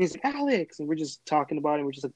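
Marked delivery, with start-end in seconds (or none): 0.2-0.8 higher-pitched happy call of a name; excited greeting. Retold or imitated. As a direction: greet him like a surprise reunion. Friend calls back his name.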